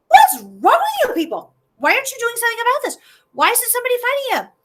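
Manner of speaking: put-on high, fast, outraged boy; a kid who can't believe it; young David. David to the soldiers, high outraged boy voice. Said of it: "David's just going like".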